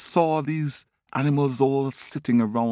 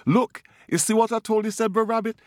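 much higher, fast frantic voice; hurried panic; 12 semitones higher, faster than usual. Acted. Brer Rabbit hurrying off from Tiger, high and fast in fake alarm. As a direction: rush out the words in fake alarm.

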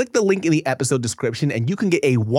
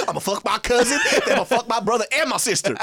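put-on Southern accent; 6 semitones higher than usual. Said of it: "Southern accent".

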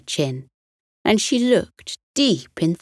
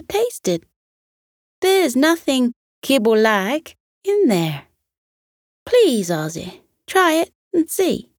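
high, eager pleading voice; pleading Missus Pig; 8 semitones higher than usual. Acted. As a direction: coax him to try it.

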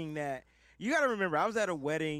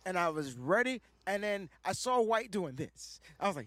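ordinary delivery

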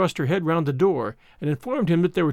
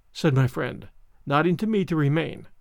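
plain reading voice

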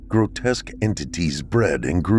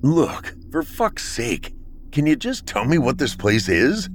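sharp, higher-pitched bark of frustration; 7 semitones higher than usual. Acted. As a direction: snap the question out, fed up. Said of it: "I barked".

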